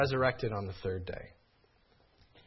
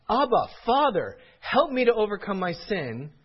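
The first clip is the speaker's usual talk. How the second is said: higher, louder pleading cry to God; 7 semitones higher, 6 dB louder than usual. Acted. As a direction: cry out for help against sin. Quoting believers' desperate cry.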